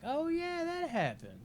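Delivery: high, drawn-out "oh yeah" of remembering; mock surprised realization; sudden recollection. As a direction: act out a memory popping back.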